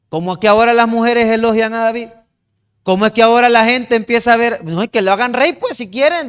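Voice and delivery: a resentful king venting about his rival; jealous complaint. Retold or imitated.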